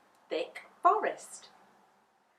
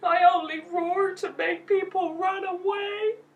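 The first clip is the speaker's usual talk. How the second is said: ashamed lion; 10 semitones higher, 6 dB louder than usual. Acted.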